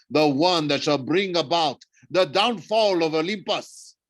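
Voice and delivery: grand villain proclamation, swelling to the climax; gloating villain. Acted. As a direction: proclaim doom with relish, slowing towards the end. Thorne proclaims the monsters' return.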